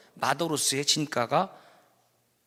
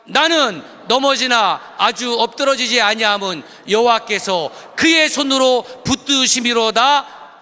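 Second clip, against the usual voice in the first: loud proclaiming; 6 semitones higher, 9 dB louder, slower than usual. Shown as a demonstration. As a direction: read it louder and higher, building to a shout. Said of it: "크게 하세요. 이거 정말 중요한 거예요".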